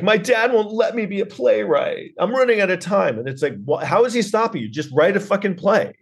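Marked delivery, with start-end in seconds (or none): 0.0-3.2 high-pitched mock complaint about dad; hammed-up, high whine of a put-upon son; whining movie son. Mocking a film's son griping about his dad, high whine. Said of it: "he's like". 3.7-5.9 high-pitched, incredulous comeback; exasperated retort. Acted. Comic scolding the whining film character, high exasperation.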